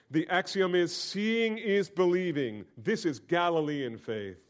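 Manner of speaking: ordinary talk, nothing marked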